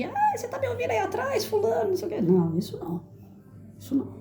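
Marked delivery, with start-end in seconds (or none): 0.0-2.2 high-pitched hysterical teacher parody; unhinged mock yelling. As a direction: imitate an off-balance speaker straining to be heard. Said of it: "não é aquele jeito desequilibrado de".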